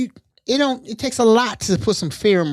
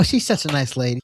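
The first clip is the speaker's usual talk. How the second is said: ordinary delivery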